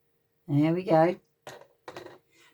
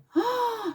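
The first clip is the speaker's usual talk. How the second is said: mock gasp. Gasp at danger.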